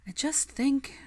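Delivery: high, searching opener; Hermione's voice. Acted. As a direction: begin the thought tentatively, high. Hermione starts her idea.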